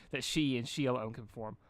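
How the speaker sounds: plain reading voice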